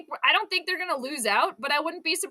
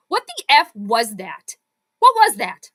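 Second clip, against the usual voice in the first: angry outburst; 2 semitones lower, 8 dB louder than usual. Real, not acted. Streamer fuming at the team's blunder, loud and exasperated. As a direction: demand an answer from the team. Fan reacts to bad play.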